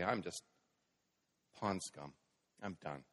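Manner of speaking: low, soft mock despair; dejected. Preacher voicing a believer who feels worthless, mildly dejected. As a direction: voice a subdued, mildly defeated admission. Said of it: "and depression".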